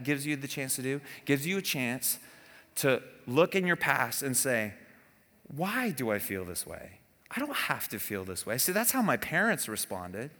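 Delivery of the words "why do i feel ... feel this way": musing inwardly, lower and softer; quiet self-reflection. Retold or imitated. Preacher voicing a listener's inner question, low and soft. Asking oneself about the past.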